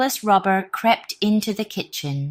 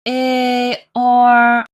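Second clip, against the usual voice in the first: louder hee-haw bray; donkey bray; 7 semitones higher, 9 dB louder than usual. Acted. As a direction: bray to scare the robbers. Animal noise attack.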